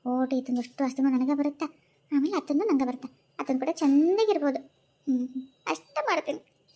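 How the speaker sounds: low, hushed plotting to herself; scheming aside. Acted. Wife plotting against her in-laws, low and hushed. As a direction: mutter the plan like a schemer alone.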